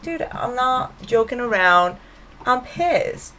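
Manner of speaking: playing a fed-up friend who means it; retold angry snap. Carrie to her late boyfriend, an imitated angry snap. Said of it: "and Carrie's pissed".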